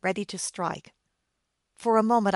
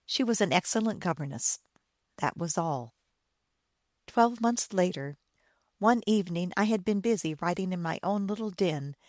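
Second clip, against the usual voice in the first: usual speaking voice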